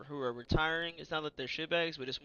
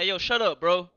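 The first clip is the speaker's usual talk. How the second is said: loud, higher-pitched fed-up outburst; frustrated retort; 4 semitones higher, 15 dB louder than usual. Retold or imitated. Young soldier telling an impatient customer off, frustrated and loud. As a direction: snap back loudly, re-enacting your frustration. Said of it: "I was getting so frustrated".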